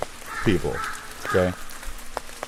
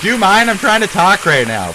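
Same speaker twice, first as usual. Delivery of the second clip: higher, louder yell over the noise; real irritated yell at a passing bus; annoyed shout; 10 semitones higher, 6 dB louder than usual. Real, not acted. Yelling at a loud bus.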